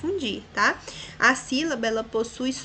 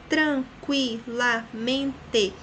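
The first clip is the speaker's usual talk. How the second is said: slow, syllable-by-syllable count; separando as sílabas; much slower than usual. Shown as a demonstration. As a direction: stretch it out beat by beat. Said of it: "Cinco impulsos de voz". (tranquilamente dito sílaba por sílaba, devagar)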